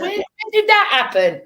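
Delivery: high, loud mock amazement; astonished. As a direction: squawk it in laughing disbelief. Astonished at own follower count.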